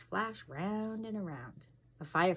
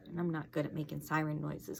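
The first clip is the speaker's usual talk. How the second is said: usual speaking voice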